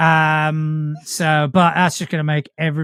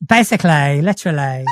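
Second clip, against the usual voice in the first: Essex accent; 4 dB louder than usual. Shown as a demonstration. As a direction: lean hard on each LY like an Essex local. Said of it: "lean on the words that end in L Y".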